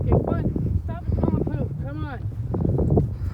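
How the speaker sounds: loud high urging; urging the dog. Real, not acted. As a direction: push the dog along, loud.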